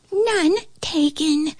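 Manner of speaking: tiny high voice, drawn out slowly; slow, high flea squeak. A narrator's reading. Flea answering the ant's apology, squeaky voice.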